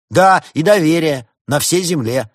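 high, loud mocking shout; 1 cheeky shout. A narrator's reading. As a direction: yell it to wind them up. Подросток кричит в ответ. (подросток дерзко выкрикивает, высоко и громко)

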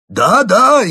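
high, loud eager yes-yes; energetic. A narrator's reading. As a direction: jump in eagerly. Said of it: "энергично продолжил Карамп".

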